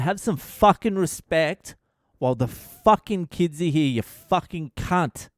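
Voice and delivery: high-pitched acted outrage; angry confrontation. Bystander standing over old Greek man, angry confrontation. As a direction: tell him off hard, swearing with force.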